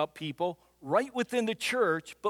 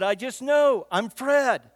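louder and higher, playfully smug; mock-proud declaration; smug insistence; 4 semitones higher, 6 dB louder, slower than usual. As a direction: insist like you know it all. Retold debate with daughter.

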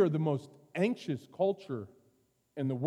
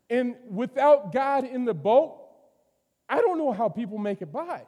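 forceful preaching; 7 semitones higher, 6 dB louder than usual. Acted. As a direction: drive the point home to the congregation.

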